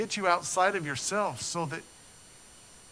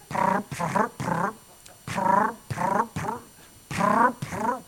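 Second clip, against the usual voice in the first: rolling prr sounds like tyres over road bumps; bump noises; 4 semitones higher, 4 dB louder, much slower than usual. Retold or imitated. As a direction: imitate tyres thudding over lane markers. Imitating car hitting lane bumps.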